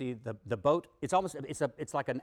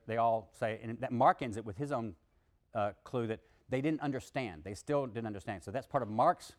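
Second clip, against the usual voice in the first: ordinary delivery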